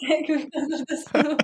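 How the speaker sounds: laughing through the words. Real, not acted. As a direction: chuckle while you give the advice. Laughing while giving advice.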